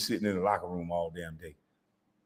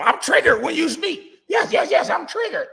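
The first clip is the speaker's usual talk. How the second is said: much higher, louder falsetto mockery; mocking triggered whine; 4 dB louder than usual. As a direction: squeal it like an overdramatic crybaby.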